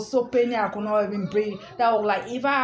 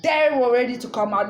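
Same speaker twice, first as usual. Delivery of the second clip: ordinary talk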